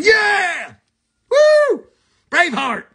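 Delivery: rowdy, high mock war whoop; battle cry. Hyping up tough Scottish people, rowdy high battle cry. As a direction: holler it like a charging warrior.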